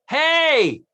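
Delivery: high, loud "hey" to get attention; mock call for help. Cohost acting out how trapped girls should hail the choir, high shout. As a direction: call out to people far below. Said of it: "you say".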